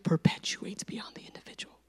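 hushed whisper, mostly without voice. Real, not acted. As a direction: drop into a soft preacher's whisper. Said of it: "I don't know why I whispered that".

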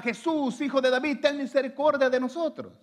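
high, loud plea from the pulpit; crying out. Acted. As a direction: cry out to Jesus for mercy. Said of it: "para poderle gritar".

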